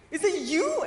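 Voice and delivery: loud, high baffled question; confused disbelief. Retold or imitated. Driver checking name against guest, comically confused. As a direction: imitate a driver who can't believe it. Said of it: "he was so confused".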